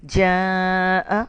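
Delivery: جاء with the long aa held very long; drawn-out recitation. Shown as a demonstration. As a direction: stretch جاء slowly as in Quran recitation. Quran word drawn out.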